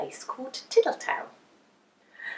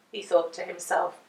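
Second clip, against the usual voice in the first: usual speaking voice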